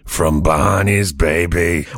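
slow, low, drawn-out artist drawl; cool artist voice. Pretend. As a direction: play the smug artist, lay it back slow. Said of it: "I'll be the artist".

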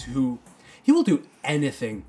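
ordinary talk

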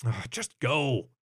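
slow, fed-up brush-off; exasperated huff. Acted. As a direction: shoo a pestering friend away. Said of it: "he huffed".

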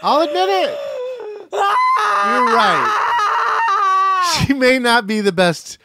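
high, loud mock-anguished scream; cracking, wailing confession; frustrated screaming. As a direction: scream it out like you can't hold it in. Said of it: "scream my frustrations out".

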